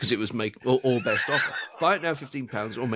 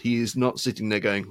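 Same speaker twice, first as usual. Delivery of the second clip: normal talking voice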